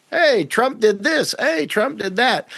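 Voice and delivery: high, slow mock excitement; mock gullible supporters. People cheering the president's every move, mock excited. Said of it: "And people are like".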